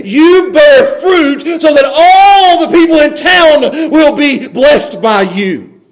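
loud, slow, high commission; drawn-out, ringing pulpit command, easing at the end; preacher's proclamation. Acted. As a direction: proclaim it as a divine charge. Voices God charging the church.

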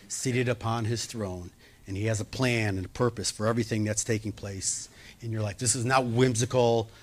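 a pastor softly reassuring a hurting congregation. Real, not acted. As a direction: comfort them quietly and sincerely.

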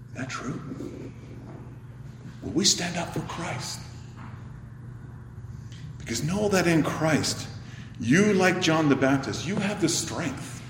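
normal talking voice

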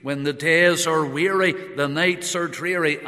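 loud, ringing recital of the hymn lines; fervent preaching. Acted. Preacher declaring the hymn to the mourners, loud. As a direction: proclaim the hymn with fervour. Quoting hymn at full force.

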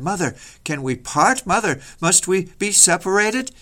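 put-on children's high, pleading cry; anguished pleading. Children clutching their mother at judgment, anguished pleading. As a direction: plead upward, rising in distress.

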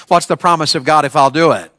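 usual speaking voice

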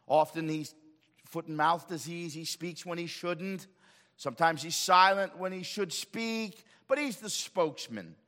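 usual speaking voice

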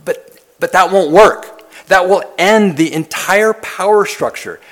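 a master protesting that it will never work; objecting master. Retold or imitated. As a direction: cry out as a master whose power is threatened. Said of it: "anyone in the culture hearing that would say".

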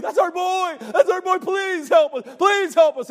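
high, loud, desperate begging shout; frantic pleading. Acted. Mother begging the preacher to save her boy, frantic shout. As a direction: scream for help like a mother losing her child. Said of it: "out of her mind with grief".

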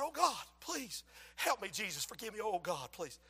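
soft, imploring retold prayer; acted humble pleading. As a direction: beg quietly for forgiveness. Retold begging God for forgiveness.

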